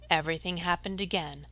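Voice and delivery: usual speaking voice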